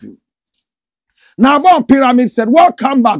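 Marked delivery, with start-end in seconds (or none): 1.4-3.2 loud, high hyped announcement; excited reading. Real, not acted. Shouting out Bon Pyramid's greeting on the live, excited. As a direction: boom out the viewer's name.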